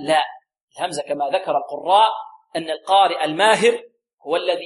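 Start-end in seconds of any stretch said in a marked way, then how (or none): none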